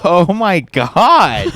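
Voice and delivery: laughing it out high. Real, not acted. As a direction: crack up on the words. Laughing at friend's taunt.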